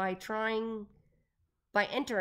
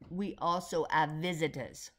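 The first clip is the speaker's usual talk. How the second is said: put-on slow Russian-accented announcement; thick Russian accent, unhurried; Doctor Yanina Zinchenko; 3 semitones lower than usual. A narrator's reading. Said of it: "in her thick Russian accent".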